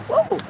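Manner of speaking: high-pitched "woo"; whoop. Real, not acted. Whoops during own chant.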